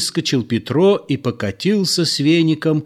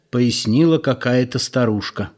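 plain reading voice

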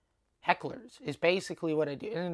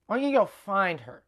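higher, louder, falsely polite send-off; pointedly dismissive; 4 semitones higher, 6 dB louder than usual. Retold or imitated. Telling Mike to leave and find her, dismissive. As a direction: suggest it while meaning get lost. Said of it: "tell by the tone of my voice".

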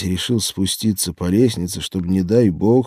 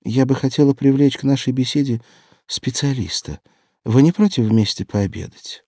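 higher, polite formal invitation; society tone; 6 semitones higher than usual. A narrator's reading. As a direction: invite with exaggerated social grace. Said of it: "таким светским тоном". (подчёркнуто светский тон)

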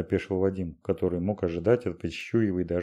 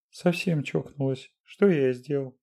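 tearful; 7 semitones higher than usual. A narrator's reading. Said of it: "всхлипнул".

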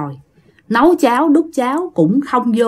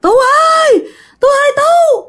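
mother crying out. Retold or imitated. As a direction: scream his name from the sickbed in panic. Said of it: "tiếng la làng của mẹ mình".

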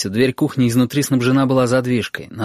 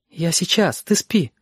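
испуганно тянет время; 6 semitones higher, slower than usual. Acted.

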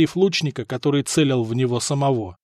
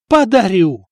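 exasperated, raised-voice reply; long-suffering; 4 semitones higher, 5 dB louder than usual. A narrator's reading. Stefan giving up his knife to brother, martyred. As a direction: give in loudly, like a martyr. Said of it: "с мученическим видом ответил Стефан".

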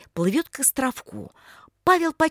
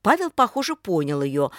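plain reading voice

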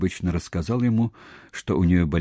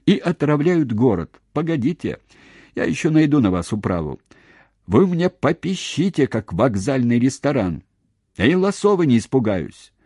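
high, loud, defiant warning; combative, raised-voice defiance; threatening tirade; 6 semitones higher, 5 dB louder than usual. Acted. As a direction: threaten them, pitch jumping up on each phrase. Acted threats in a quarrel.

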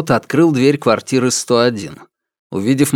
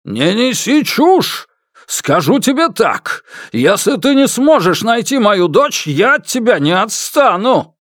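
choking with anger; 10 semitones higher than usual. A narrator's reading. Father jabs his finger at the man, choking with anger. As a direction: threaten him angrily in a raised pitch. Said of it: "задохнувшись от гнева".

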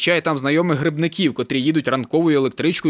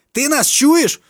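loud demand; 10 semitones higher, 5 dB louder, much slower than usual. A narrator's reading. Friends yelling on phone.

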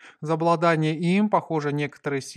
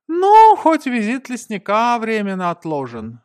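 high, loud character voice; Gonza, finding the positive; 7 semitones higher, 10 dB louder than usual. A narrator's reading. Gonza consoling himself over postponed visit, high loud voice. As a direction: point out the upside, high and loud. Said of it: "вычленил положительный момент Гонза".

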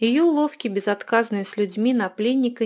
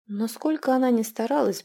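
usual speaking voice

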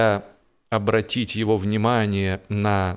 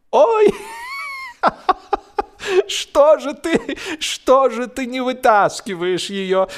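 choking with laughter. A narrator's reading. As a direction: laugh so hard the question barely gets out. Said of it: "давясь от смеха".

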